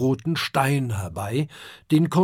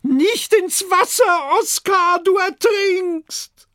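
very high, loud, slow shriek of a mother; Adele's panicked cry; 6 dB louder, slower than usual. A narrator's reading. Mother Adele shrieks at her son in the water, panicked. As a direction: scream the warning as if he's drowning. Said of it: "ein heiserer Schrei ist zu hören".

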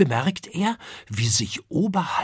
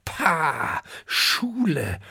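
high, sneering scoff; young dragon sneering at school; scornful; 6 semitones higher, much slower than usual. Acted. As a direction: turn your nose up at the word. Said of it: "rümpft die Nase".

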